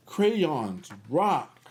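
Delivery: ordinary delivery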